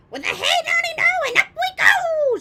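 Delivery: put-on high, loud cackling old crone's chant; wild shout. A narrator's reading. Grandma to George while growing, shouting gleefully. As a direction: belt it out gleefully as a mad granny. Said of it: "she shouted".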